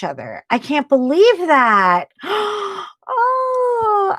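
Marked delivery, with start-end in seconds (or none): 3.1-4.2 sharp gasp into a high crying oh; gasp, voice catching with emotion; emotional gasp. Real, not acted. Viewer lived nearby, gasping emotionally. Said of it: "I am so emotional right now".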